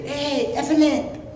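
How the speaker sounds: put-on very high, drawn-out Mexican-accented squeak; Mexican rabbit. Rabbit hailing the elephant, high Mexican-accented call. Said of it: "It was a Mexican rabbit".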